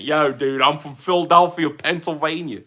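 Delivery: hammed-up Delco local accent; fake Delco accent. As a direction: do a mock Philadelphia-area Delco accent. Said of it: "doing the fake Delco accent".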